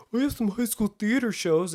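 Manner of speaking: high-pitched imitation of his younger self; naive protest. Young actor defending a thin resume to a skeptic, naive protest. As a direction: pipe up high with a hopeful objection.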